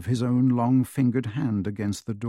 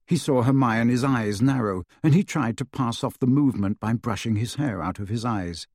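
usual speaking voice

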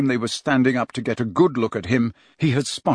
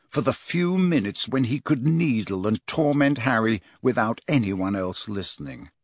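plain reading voice

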